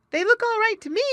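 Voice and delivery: very high, loud little otter; otter character voice. A narrator's reading. Little Joe Otter answering his muskrat friend, high and loud. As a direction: pipe up loudly at the top of the voice.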